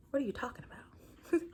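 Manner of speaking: breathless whisper, high and startled; whispered disbelief. Retold or imitated. Startled reply to inner voice.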